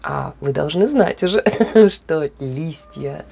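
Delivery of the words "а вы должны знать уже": ordinary talk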